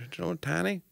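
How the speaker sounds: put-on high, soft southern accent. Friend describing an espresso cup, southern accent. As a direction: answer in a small, high southern voice. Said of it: "go into that southern accent".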